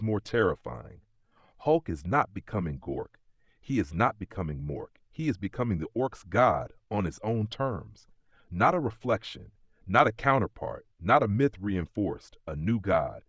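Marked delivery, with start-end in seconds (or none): none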